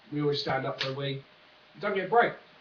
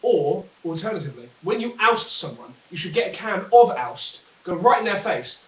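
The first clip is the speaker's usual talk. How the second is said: ordinary delivery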